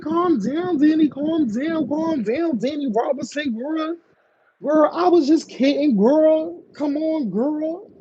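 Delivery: high, sing-song pretend pleading to calm down; playful mock soothing. Host imagining calming a screaming caller, playful teasing. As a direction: tease them with a high-pitched "just kidding" coaxing.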